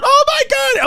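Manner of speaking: very high mock scream; imitating daughter's shriek. Parent mimicking daughter at burning car, high mock shriek. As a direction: squeal it like a horrified kid. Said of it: "And my daughter said".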